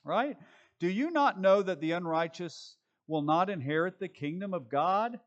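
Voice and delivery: normal talking voice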